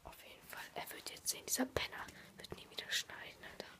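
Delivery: whispering. Real, not acted. Whispering beside sleeping friend.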